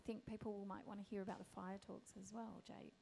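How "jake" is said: ordinary talk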